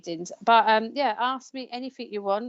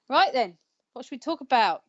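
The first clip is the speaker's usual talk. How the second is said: usual speaking voice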